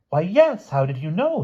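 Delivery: high-pitched astonished question; amazed. A narrator's reading.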